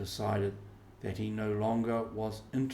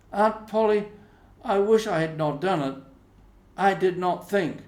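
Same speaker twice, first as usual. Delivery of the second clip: high, loud contrite plea; a boy begging pardon; sorry boy Tom; 9 semitones higher, 9 dB louder than usual. Acted. Tom to Aunt Polly, apologizing, high.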